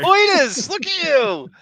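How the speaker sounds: high, loud delighted outburst; teasing co-host for a fancy word, laughing; laughing tease. Real, not acted. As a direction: crack up teasing a friend.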